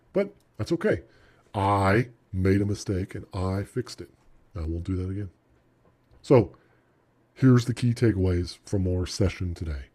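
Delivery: normal talking voice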